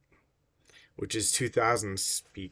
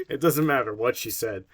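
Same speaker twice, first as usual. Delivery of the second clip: ordinary talk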